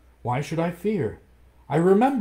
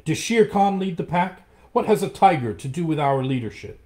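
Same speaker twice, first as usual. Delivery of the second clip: much higher and louder, indignant; defiant challenge; 7 semitones higher, 7 dB louder than usual. Acted. Mowgli challenges the pack over the tiger, loud and indignant. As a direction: throw the challenge at the whole pack.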